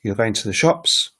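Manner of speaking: "You're going to the shops" lifting on "shops"; rising question intonation. Shown as a demonstration. Teacher showing the intonation change, "You're going to the shops" rising at the end. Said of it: "the intonation changes".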